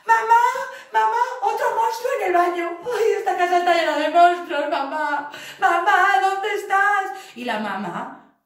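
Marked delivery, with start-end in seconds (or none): 0.1-7.0 put-on high, loud frightened kid voice; pitched-up kitten yelling for mum; scared child calling. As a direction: cry out for mum in a panic. Child cat panicking.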